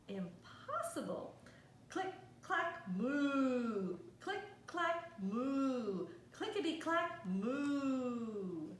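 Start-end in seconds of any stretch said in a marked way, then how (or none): 1.9-8.7 typing and mooing. Acted. Cows typing sound refrain.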